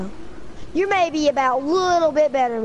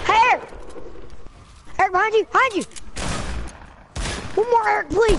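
yelling across the room; 8 semitones higher, faster than usual. Real, not acted. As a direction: shout across the room, urgent. Calling teammate across room.

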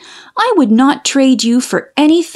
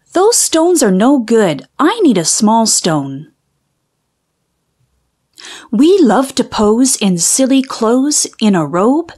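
ordinary delivery

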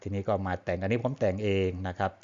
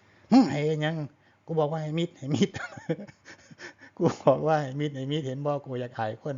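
high-pitched Isan granny telling off her grandchild; scolding grandmother; 7 semitones higher than usual. Retold or imitated. Grandma smacks grandchild for embarrassing her, mock scolding. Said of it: "ตีหลานปั๊บเลยนะ".

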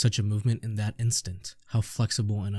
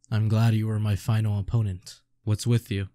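normal talking voice

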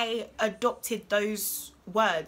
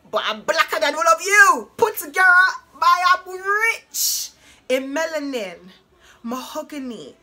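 loud, higher, declaring it with swagger; proud boast; 6 semitones higher, 10 dB louder than usual. Real, not acted. Answering people who called her an Oreo, loud and proud. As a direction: throw it out like a triumphant comeback.

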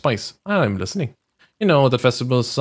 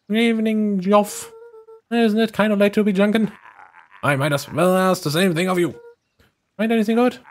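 put-on higher, cheerful young voice; high-pitched friendly voice; 7 semitones higher than usual. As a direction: pipe up brightly. Voices character Jeff.

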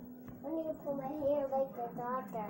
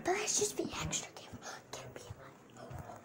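fast, hushed whisper; whispering while hiding; 13 semitones lower, 8 dB quieter, faster than usual. Real, not acted. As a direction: whisper so nobody hears you. Kids sneaking up to prank.

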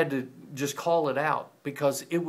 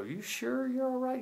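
much higher, coaxing question; worried high voice; 11 semitones higher, 4 dB quieter than usual. Retold or imitated. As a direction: ask gently but nervously, voice way up. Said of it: "You go".

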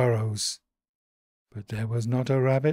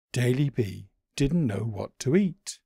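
ordinary delivery

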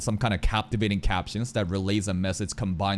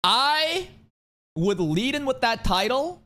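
excited; 13 semitones higher, slower than usual. Real, not acted. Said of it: "voice jumps up".

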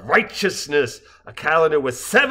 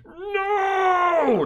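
crowd scream. Acted. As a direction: howl it like an angry crowd. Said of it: "The crowd screamed".

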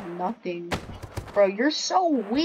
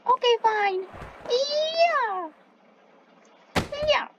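high-pitched reluctant okay; squeaky high voice; 13 semitones higher, slower than usual. Acted. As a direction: squeak it out, grudging. Repeat, much higher pitch.

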